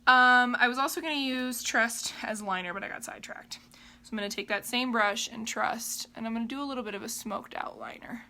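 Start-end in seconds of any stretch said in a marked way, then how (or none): 2.7-4.1 soft unvoiced whisper. Real, not acted. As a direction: drop to a breathy whisper. Whispered asides while doing makeup.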